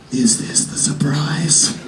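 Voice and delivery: slow unvoiced aside; hushed doubtful whisper to yourself. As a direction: whisper it in disbelief. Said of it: "I'm just looking around like".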